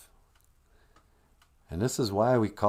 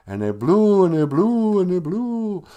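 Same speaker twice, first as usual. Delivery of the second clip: rising higher and louder on each blew; building wind; 8 semitones higher, 8 dB louder than usual. A narrator's reading. Narrator tells how the winds blew, building louder and higher. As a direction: let the wind swell with each repeat.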